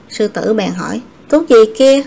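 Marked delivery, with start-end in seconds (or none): 1.3-2.0 lion barks the question, loud; sharp, loud royal demand; a lion booming at the fox. Acted. Lion demands what medicine.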